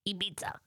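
Ibiza whispered ee-BEE-tsa; a whispered foreign Ibiza; whispered Spanish Ibiza. Shown as a demonstration. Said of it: "We say Ibiza".

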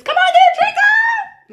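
very high, loud, stretched cheer; party cheer. Retold or imitated. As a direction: hype up the bride to drink. Mimicking cheering at a bar.

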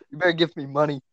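ordinary delivery

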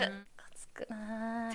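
soft unvoiced whisper. Real, not acted. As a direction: breathe it out as a whisper. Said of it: "whispered aside".

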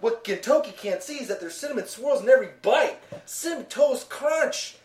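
commercial announcer. Pretend. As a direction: sell it like a TV ad. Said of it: "It's how the commercial goes".

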